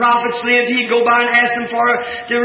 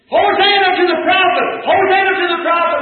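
crowd screaming; 7 semitones higher than usual. Retold or imitated. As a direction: cry it out like a cheering crowd. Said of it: "people screaming".

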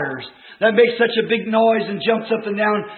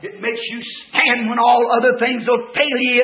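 ordinary delivery

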